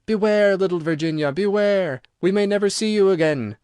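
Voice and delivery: usual speaking voice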